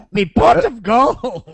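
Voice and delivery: put-on high, slow, drunken leprechaun; Scottish leprechaun. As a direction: slur it like a tipsy Irish elf. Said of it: "was that a Scottish leprechaun".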